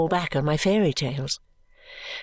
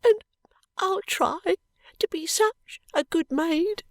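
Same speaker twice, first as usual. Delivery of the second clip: much higher, tearful and slow; promising through tears; tearful little maid; 11 semitones higher, slower than usual. A narrator's reading. Charlie vowing to Esther, tearful and higher.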